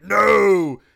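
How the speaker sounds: a sudden loud NO; mock shouted no. Christopher Lee yelling no at the werewolf. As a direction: bark out a hammed-up NO. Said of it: "he's like".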